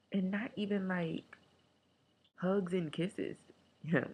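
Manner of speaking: ordinary talk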